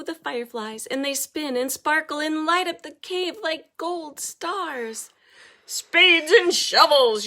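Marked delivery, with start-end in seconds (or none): 5.7-7.3 high, loud, slow villain's yelp. Acted. Villain cries out as the light hits, loud yelp. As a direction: cry out like a blinded villain. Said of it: "yelps Odysseus".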